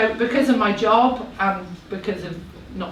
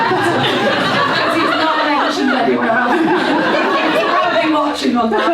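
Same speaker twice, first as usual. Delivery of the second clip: louder, higher, through real laughter; laughing aside; 7 semitones higher, 6 dB louder than usual. Real, not acted. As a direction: laugh while calling out the joke. Joking aside about absent husband, room laughing.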